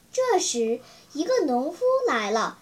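ordinary delivery

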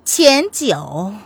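long, lingering syllables; drawn-out voice. A narrator's reading. Lili addresses the prince mockingly, drawing it out. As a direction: stretch out each syllable of the name. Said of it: "黎黎拖长声音道".

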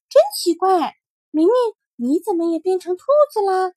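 put-on a rabbit astonished at the boy; amazed little rabbit. Rabbit marvelling at Mingming's red eyes, high and amazed.

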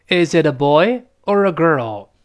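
put-on "boy" rising, "girl" falling; rise then fall. Shown as a demonstration. Teacher leading students' repetition, up on "boy", down on "girl". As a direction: raise "boy" and bring "girl" down. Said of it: "boy上去，girl降下来".

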